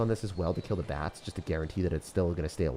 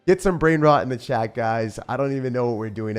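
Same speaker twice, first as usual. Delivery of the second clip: loud, high hyped banter; 6 semitones higher, 10 dB louder than usual. Real, not acted. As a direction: hype up the chat about the fail. Loud hyped self-mockery to chat.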